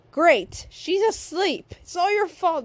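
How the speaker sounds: loud, fed-up acted complaint; exasperated blame. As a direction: blame a friend in mock anger. Acted blaming in an argument.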